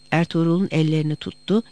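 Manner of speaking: usual speaking voice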